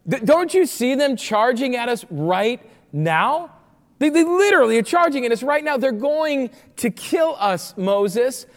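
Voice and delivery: loud, high, hammed-up panic; freaked-out protest. Preacher acting Israelites telling Moses they will be killed, panicked. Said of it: "freaked out, terrified".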